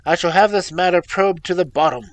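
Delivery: father vows to the son to probe the matter; furious vow. A narrator's reading. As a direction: swear it through rage. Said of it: "half mad with grief and rage".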